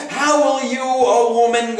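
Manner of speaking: normal talking voice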